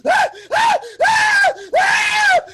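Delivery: high, loud panicked screams; panicked screaming. Acted. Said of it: "he starts to freak out and he's like".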